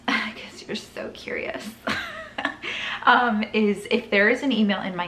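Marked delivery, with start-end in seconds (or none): 0.1-1.7 whisper. Real, not acted. Teasing aside to viewers. 1.7-3.0 squeaky giggle. Real, not acted. Laugh after whispered aside.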